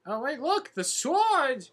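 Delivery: high, loud excited call. Real, not acted. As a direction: point it out with a thrill. Spots the sword excitedly.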